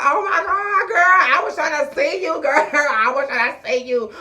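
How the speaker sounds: put-on high, wailing drunk lady, half crying. Drunk lady greets Mercedes in jail, high tearful wail. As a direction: wail it like a sloppy drunk friend. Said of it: "She's like".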